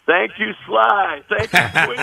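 slow, pointed thanks with pauses; dramatic pause. Acted. Songwriter mocking the lost song, slow with dramatic pauses. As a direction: let each thanks hang sarcastically. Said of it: "I waited for the dramatic pause there".

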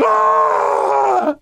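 high, drawn-out imitated crowd yell; crowd roar. Announcer imitating the crowd's reaction, high yell. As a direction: roar like a whole arena erupting. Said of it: "And the whole place goes".

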